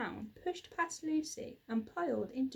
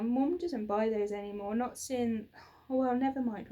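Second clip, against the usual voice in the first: usual speaking voice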